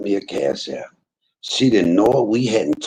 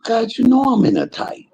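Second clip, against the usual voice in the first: high, louder whiny plea to God; mock pleading; 9 semitones higher, 3 dB louder than usual. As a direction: beg God like a broke kid, voice up high. Pleading with God in retold scene.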